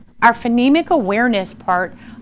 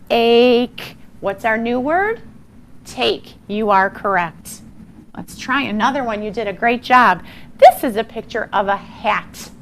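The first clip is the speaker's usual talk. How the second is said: ordinary talk, nothing marked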